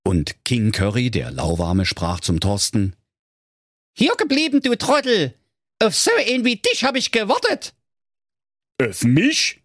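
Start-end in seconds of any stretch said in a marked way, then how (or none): 4.0-7.7 high, pompous little king calling out; King Curry. A narrator's reading. Currywurst king calling Thorsten over on the steps, high and bossy. 8.8-9.6 surprised. A narrator's reading. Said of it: "fragte der Thorsten erstaunt".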